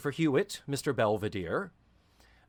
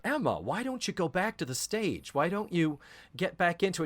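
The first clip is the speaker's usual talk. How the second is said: higher-pitched coaxing friend; voiced friend; 6 semitones higher than usual. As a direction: gently urge an old pal, pitched up. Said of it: "her friends say to her".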